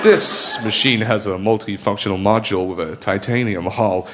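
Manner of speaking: deep, grand trailer voice, American; American movie voice. Acted. Comic mocking American sci-fi, deep trailer voice. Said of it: "In American, that's a spaceship".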